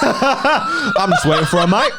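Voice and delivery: high, loud, slow laughing put-on voice; imitating a lazy chef caught out; mock chilled lad. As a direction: drawl it like a cheeky lad. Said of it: "imitating the chilled chef".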